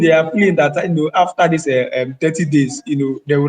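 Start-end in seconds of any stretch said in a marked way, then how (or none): none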